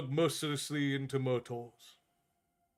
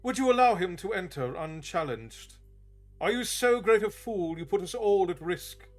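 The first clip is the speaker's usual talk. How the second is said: higher, louder scornful challenge; angry demand; 5 semitones higher, 5 dB louder than usual. Acted. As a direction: demand it with contempt. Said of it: "demanded the bald man".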